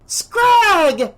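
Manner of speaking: very high, loud shriek of a name; yelling. A narrator's reading. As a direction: scream to stop the fight. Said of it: "Sandra yelled an instant later".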